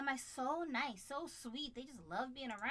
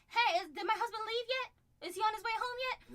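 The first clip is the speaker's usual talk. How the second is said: higher, louder and eager, the second half rushed; eager wife calling; 9 semitones higher, 6 dB louder than usual. Retold or imitated. Wife phoning her husband's job, higher and louder with eagerness. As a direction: bubble over like a wife who can't wait. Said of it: "She calling".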